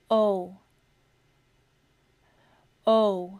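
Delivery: ओ held as a long rounded oh; long o sound. Shown as a demonstration. Teacher modelling ओ, long and clear. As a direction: round the lips and hold ओ. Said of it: "फिर है long ओ का sound".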